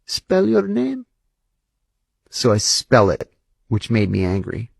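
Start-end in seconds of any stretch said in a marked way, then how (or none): 0.0-1.1 slow, high, suspicious officer demanding; lightly voiced interrogator, slow and cold; Shin Bet officer. Shin Bet guy grilling the CIA officer, slow and suspicious. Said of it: "and he says".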